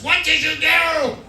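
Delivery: high, loud, slow scolding; hammed-up outraged yell; angry nurse shout. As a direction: holler it like a furious nurse. Angry nurse returns and scolds him.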